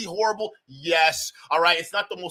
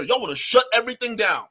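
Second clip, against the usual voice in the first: ordinary delivery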